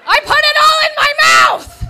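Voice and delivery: very high, very loud yelp; laughing panicked shout. Retold or imitated. To manager reaching for chocolate, loud laughing shriek. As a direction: shriek to stop her grabbing it. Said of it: "I said".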